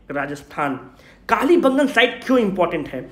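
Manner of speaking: ordinary talk, nothing marked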